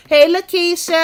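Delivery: loud high greeting to a chatter; calling out. Real, not acted. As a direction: call her name out bright and loud.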